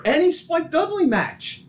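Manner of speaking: high, drawn-out exclamation; emphatic agreement. Real, not acted. Excited agreement listing examples.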